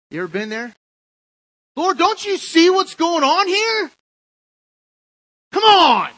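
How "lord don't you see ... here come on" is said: much higher, louder, slower whining complaint; exasperated Martha. Acted. Preacher repeating Martha's complaint even more exasperated. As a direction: wail it out, fed up and put upon. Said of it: "when we're in Martha mode".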